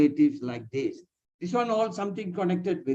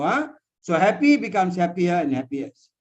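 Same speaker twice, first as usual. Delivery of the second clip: ordinary delivery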